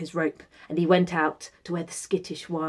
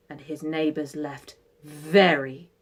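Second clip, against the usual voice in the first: ordinary delivery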